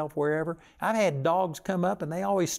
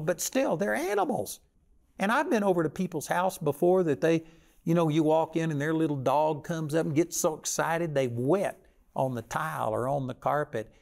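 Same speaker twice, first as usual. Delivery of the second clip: ordinary delivery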